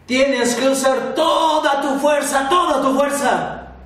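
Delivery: master shouts up high, loud and urging; shouting. A narrator's reading. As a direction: call out to push the boy to use all his strength. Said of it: "le gritó a Hércules".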